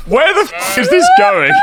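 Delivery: high shocked shriek into laughter. Real, not acted. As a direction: yelp in disbelief.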